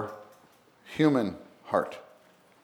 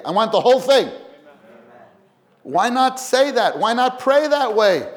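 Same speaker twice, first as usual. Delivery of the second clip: loud, much higher preaching; preaching at full force; 11 semitones higher, 8 dB louder than usual. Acted. Preacher urging saints to pray boldly, loud and high. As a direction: rise up and exhort the church.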